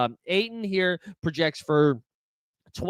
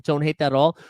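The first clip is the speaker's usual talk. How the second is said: usual speaking voice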